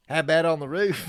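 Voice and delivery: lightly voiced Australian accent quote; a hint of Australian accent, amused. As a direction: toss off the line in a mock Australian accent. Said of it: "in an Australian accent".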